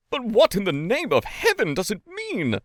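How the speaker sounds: high, alarmed outburst; bursting out. A narrator's reading. Lawyer startled by the wailing, high and alarmed. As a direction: blurt it in alarm. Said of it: "broke out the lawyer".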